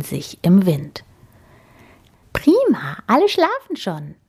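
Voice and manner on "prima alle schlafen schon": put-on giggly boar girl. A narrator's reading. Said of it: "sagte Amalie und kicherte".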